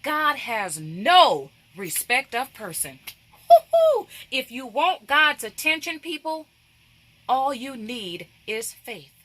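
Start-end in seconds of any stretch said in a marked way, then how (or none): 3.5-4.1 high, loud "woo-hoo"; sudden loud woo-hoo; excited whoop. Real, not acted. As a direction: let out a joyful high whoop. Preacher whoops mid-sermon.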